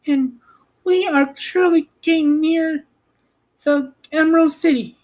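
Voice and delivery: put-on high young girl voice, curious; Dorothy's girl voice. Girl asking about Oz, high and curious.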